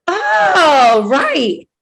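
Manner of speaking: sudden recognition. Retold or imitated. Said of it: "And they're like".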